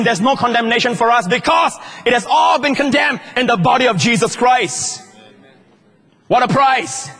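high, loud impassioned sermon voice; preaching at full force. Acted. Preacher to church about the price paid, loud high preaching. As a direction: build it up and ring it out to the congregation.

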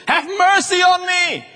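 high, slow, drawn-out cry; shouted plea. Acted. Blind beggar pleads with Jesus for mercy, shouting. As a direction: wail the plea out loud and high.

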